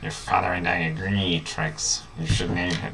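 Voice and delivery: lightly voiced raspy old villain; a hint of a sinister emperor; Palpatine-like voice. Parent speaking to Trix in a lightly sinister emperor voice. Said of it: "That's like Palpatine".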